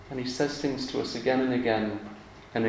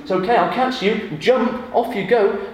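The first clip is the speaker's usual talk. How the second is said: higher, louder cheerful coaxing; encouraging dad; 7 semitones higher, 6 dB louder than usual. Retold or imitated. Father encouraging his child on the wall, cheerful. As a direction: call up to the child to jump. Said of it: "I'll say to them".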